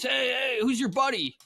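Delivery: hammed-up jeering welcome; mock loud greeting. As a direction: call it out like a rowdy teammate. Teammate mocking newcomer loudly.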